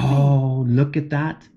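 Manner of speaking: ordinary delivery, nothing marked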